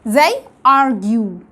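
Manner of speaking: "argue" drawn out very slowly with a long yoo ending; slow word demo. Shown as a demonstration. Teacher models the example word, very slowly. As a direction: stretch "argue" for the class. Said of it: "وبعديه إي".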